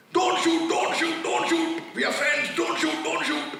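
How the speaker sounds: frantic high-pitched pleading cry; panicked surrender shout. Retold or imitated. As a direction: yell it like guns are on you. Soldier pleading with armed militants.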